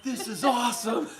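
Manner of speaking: high, laughing retold exclamation; laughing delight. Onlooker relishing the shop owner's rant, laughing delight.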